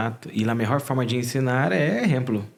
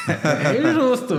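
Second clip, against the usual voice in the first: high, slow, laughing through the words; real laughter, pitched up and drawn out; laughing protest; 11 semitones higher, 5 dB louder, slower than usual. Real, not acted. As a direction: laugh it off as unfair. Laughing about unfair criticism.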